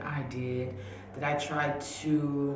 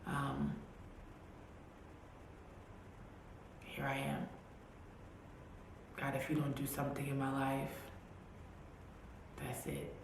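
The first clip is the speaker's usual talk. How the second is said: softer, broken whispered prayer; a fading retold cry, softer and softer; crying to God; 9 dB quieter than usual. Praying in church on her knees, softer, broken. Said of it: "crying hysterically and saying".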